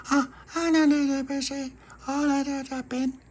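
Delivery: put-on very high babbling toddler; baby voice. Narrator voicing toddler Baby Lucy babbling, high baby voice. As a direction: squeak it like a tiny baby.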